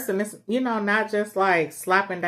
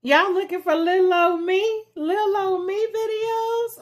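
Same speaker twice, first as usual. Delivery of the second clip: high teasing voice; 14 semitones higher, 4 dB louder than usual. Pretend. Playful teasing opener to viewers.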